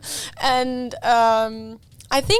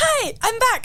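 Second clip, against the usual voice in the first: cheery return; 12 semitones higher than usual. Retold or imitated. Returning to the boat company.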